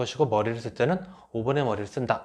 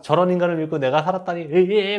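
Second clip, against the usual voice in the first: louder, higher mock cry of disbelief; mock outraged wife; 5 semitones higher, 5 dB louder than usual. Grieving wife scolding her cold husband, loud mock outrage. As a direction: ham up the betrayed wife's outburst. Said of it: "막 이러면서 막 그런다".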